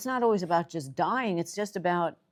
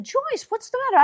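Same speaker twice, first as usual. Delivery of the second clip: much higher and louder, alarmed; 7 dB louder than usual. Retold or imitated. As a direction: retell the panicked question to the crying sister. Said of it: "I was so upset".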